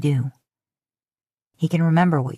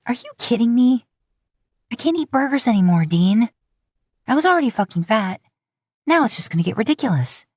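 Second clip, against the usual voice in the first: higher and strained, close to tears; tearful, scoffing outburst; 7 semitones higher than usual. Acted. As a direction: blurt it out through tears, a little raised. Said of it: "You scoff at him through your tears".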